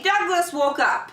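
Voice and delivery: ordinary delivery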